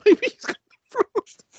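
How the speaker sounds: squeaky high, slow, through laughter; laughing child impression. Father repeats son's line, cracking up in a high voice.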